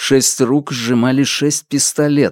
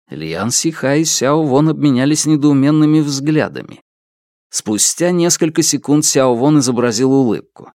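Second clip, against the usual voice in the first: usual speaking voice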